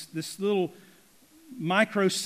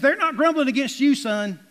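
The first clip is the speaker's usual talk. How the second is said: raised, loud, thundering rebuke; angry God; 8 semitones higher, 8 dB louder than usual. Acted. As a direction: boom it out in acted divine anger. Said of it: "God just gets angry".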